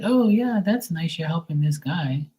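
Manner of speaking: imitating the blonde woman, high and sweet; flirty high voice. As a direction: mimic her cooing at the guy. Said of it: "she was like".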